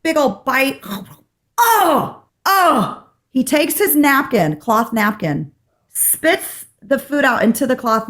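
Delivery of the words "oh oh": high-pitched "oh" of revulsion; mock disgusted groan. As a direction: imitate recoiling from a foul bite. Acts out tasting hated squash.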